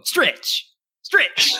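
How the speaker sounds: high excited home run call; Hawk Harrelson impression. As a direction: belt it like a TV announcer. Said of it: "Hawk Harrelson would go".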